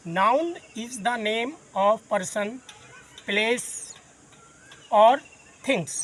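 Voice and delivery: very slow, word by word, for note-taking; slow dictation. Shown as a demonstration. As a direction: spell it out slowly so students can write. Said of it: "teacher dictating definition". (धीमे-धीमे लिखवाना)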